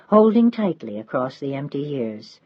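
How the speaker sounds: plain reading voice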